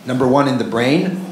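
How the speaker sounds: ordinary delivery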